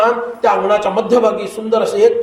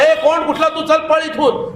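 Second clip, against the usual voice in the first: retold servant's yelling; 6 semitones higher than usual. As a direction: imitate a servant shouting someone away. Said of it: "ओरडायला लागला".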